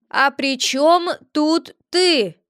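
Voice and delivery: slow, high put-on spirit voice; spirit's sly question. A narrator's reading. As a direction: ask it slowly, slyly. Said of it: "спросил Вереней".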